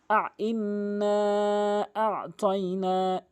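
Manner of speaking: drawn-out tajweed recitation; slow recitation. Shown as a demonstration. A teacher recites slowly for students. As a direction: chant each syllable slowly and evenly. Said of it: "Quran recitation demo".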